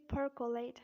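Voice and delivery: American "percolate" with R sounded; American accent. Shown as a demonstration. Teacher contrasting for learners, American accent "percolate". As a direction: say 'purr-kuh-late', American style. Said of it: "But in American accent, we say".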